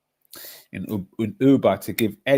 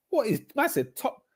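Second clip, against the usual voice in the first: incredulous squeal; 13 semitones higher than usual. Real, not acted. Mocking outrage continues.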